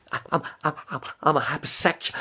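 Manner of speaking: quick, stammering neurotic comedian; Woody Allen. Acted. Repeating his party piece for viewers, stammering neurotic impression. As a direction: trip over the first words in a nervy impression. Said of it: "I did my, my Woody Allen".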